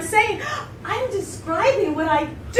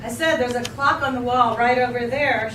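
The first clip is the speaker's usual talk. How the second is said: touch of impatience; 6 semitones lower than usual. Acted. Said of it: "with a touch of impatience".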